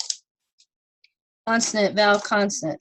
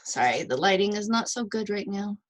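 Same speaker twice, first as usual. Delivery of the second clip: usual speaking voice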